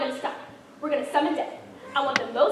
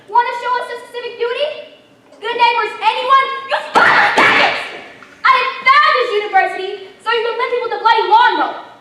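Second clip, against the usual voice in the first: higher and louder, raging; furious rant; 7 semitones higher, 7 dB louder than usual. Acted. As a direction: bellow at the cowering staff. Archchancellor raging at the staff.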